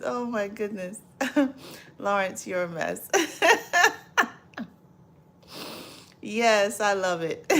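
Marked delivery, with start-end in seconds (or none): 3.1-4.3 loud high laughter; big laugh. Real, not acted. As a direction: laugh hard at the joke. Laughing at Lawrence's comment.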